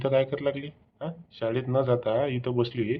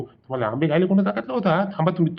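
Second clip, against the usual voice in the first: high, raised-voice telling-off; indignant, pitched-up challenge; angry scolding; 7 semitones higher, faster than usual. Acted. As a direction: scold the caught thieves. Owner scolds caught mango thieves.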